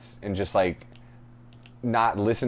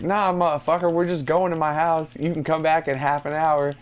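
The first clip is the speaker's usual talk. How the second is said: higher-pitched brushing-off; insistent persuading; 6 semitones higher than usual. Retold or imitated. Friends drag Mike from his house, pushy insistence. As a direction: override the objection in a raised pitch.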